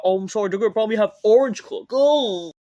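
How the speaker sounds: loud, high, fast mock apology; mock-dismayed high shout; sarcastic outburst. Real, not acted. Sarcastic guess at booking.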